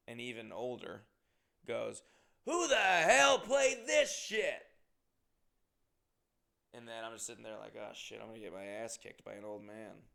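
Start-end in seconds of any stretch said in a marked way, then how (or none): 2.4-4.7 loud, high, slow drawn-out holler; drunken yell. Retold or imitated. Drunk old man objecting to jukebox song, drunken yell. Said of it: "who's wasted drunk". 7.8-10.1 soft aside to oneself; muttered mock worry, under the voice; quiet dread. Drinker fearing a beating from old man, quiet dread. Private dread after drunk's outburst.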